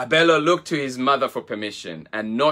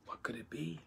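unvoiced suspense whisper; breathless storyteller whisper; 21 dB quieter, faster than usual. A narrator's reading. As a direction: hush it like a secret. Narrator builds suspense.

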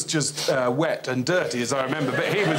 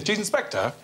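"Cheese Inspector" run together quickly, high; said really quickly; 8 semitones higher than usual. Shown as a demonstration. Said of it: "I said it really quickly".

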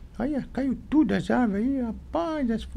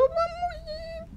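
high little voice; 4 dB quieter than usual. Acted. Tiny ant voice in story.

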